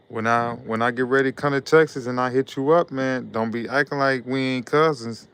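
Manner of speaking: ordinary delivery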